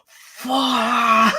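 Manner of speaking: sudden high yell; yelled curse. Retold or imitated. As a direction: blurt out a curse. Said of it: "Roscoe literally yelled out".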